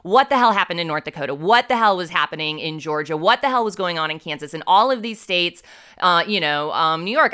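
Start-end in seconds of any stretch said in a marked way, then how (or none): none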